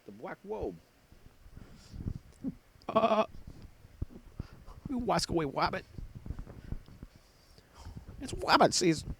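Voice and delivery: high cartoon hunter lisp, w for r; lispy hunter voice, Wobe and Wabbit; cartoon hunter whispering about rabbits; Elmer Fudd. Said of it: "Elmer Fudd".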